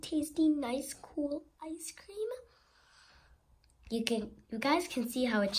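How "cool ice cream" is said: slow, softer whispered question; slow hushed wonder. Acted. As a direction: draw it out in a whisper. List ends whispered.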